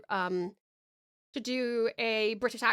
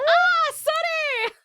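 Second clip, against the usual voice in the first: high, loud startled cry; 8 dB louder, much slower than usual. Real, not acted. Streamer jolted by a donation alert: high startled yelp. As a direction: jump at the sudden sound.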